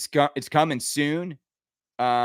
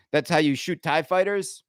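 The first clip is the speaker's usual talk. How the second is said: ordinary talk, nothing marked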